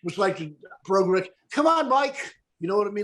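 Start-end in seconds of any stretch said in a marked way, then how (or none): 1.5-2.3 Irish brogue. Retold or imitated. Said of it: "let's hear it".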